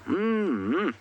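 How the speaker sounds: high, swooping "mmm-mmm"; mock yummy hum. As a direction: hum as if relishing a delicious meal. Savouring the vultures' revolting meal.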